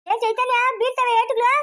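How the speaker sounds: loud parental scolding; scolding threat. Acted. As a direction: warn a child sharply that a smack is coming. Threatening a child with a beating.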